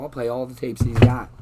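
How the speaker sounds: angry outburst. Real, not acted. Angry threat.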